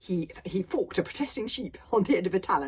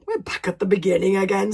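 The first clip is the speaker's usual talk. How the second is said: weary sigh; 12 dB louder than usual. A narrator's reading. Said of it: "sighed the Green Death".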